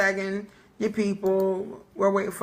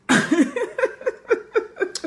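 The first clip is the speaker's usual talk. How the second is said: high burst of laughter; 14 semitones higher than usual. Real, not acted. Laughing at being half asleep.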